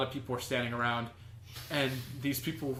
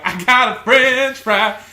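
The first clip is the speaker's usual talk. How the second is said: louder and higher, slow and joyful; gleeful costumed actor; 8 semitones higher, 14 dB louder, slower than usual. Retold or imitated. Friend repeats the actor's joyful cry with the French fry, loud and high. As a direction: crow it like a proud child. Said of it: "and he was like".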